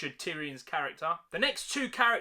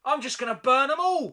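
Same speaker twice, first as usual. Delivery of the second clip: mock Daenerys; 8 semitones higher, 7 dB louder than usual.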